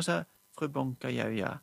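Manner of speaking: usual speaking voice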